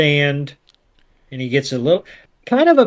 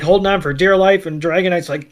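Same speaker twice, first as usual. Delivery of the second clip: ordinary talk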